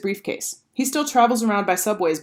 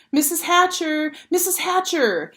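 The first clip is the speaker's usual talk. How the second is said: put-on high, slow, eager girl calling; 10 semitones higher, slower than usual. A narrator's reading. Sheila calling to Peter's mother, high and eager. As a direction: call out eagerly to win her over. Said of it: "Sheila called".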